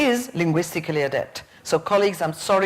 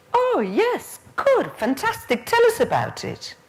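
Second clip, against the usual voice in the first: high, gushing imitation of a teacher; mock eager encouragement; 9 semitones higher than usual. Nervous trainee teacher urging a pupil on, high-pitched mock enthusiasm. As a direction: pitch it way up, over-keen to encourage.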